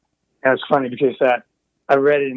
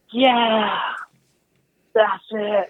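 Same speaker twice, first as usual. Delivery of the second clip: excited cheer; 9 semitones higher, much slower than usual. Retold or imitated. Engineers cheering in control room.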